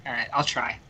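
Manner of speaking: usual speaking voice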